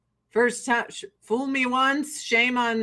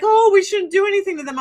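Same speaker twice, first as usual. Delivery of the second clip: high, loud, hurried mocking quote; mock objection; 7 semitones higher, 6 dB louder, faster than usual. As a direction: imitate a fretful objector. Mocking what everyone says.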